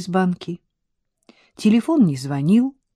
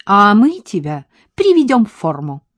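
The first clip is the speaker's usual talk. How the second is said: louder, higher, bubbly woman's voice; perky sing-song; 3 semitones higher, 7 dB louder than usual. A narrator's reading. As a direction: sing it out with mischief. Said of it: "с задорными интонациями пропела Милка". (задорно пропеть, громче и выше)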